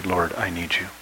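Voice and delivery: low, very soft prayer; an understated cry to God, almost private; hushed plea. Acted. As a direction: pray it quietly and low. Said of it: "that's one of my cries".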